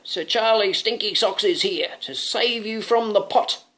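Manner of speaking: lightly voiced brave knight; heroic knight. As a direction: announce the rescue proudly. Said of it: "cried the knight".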